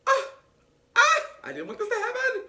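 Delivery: high, squeaky fake crying; comic high-pitched blubbering; mock sobbing. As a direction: imitate a tearful breakdown, hammed up. Imitating his tearful relief.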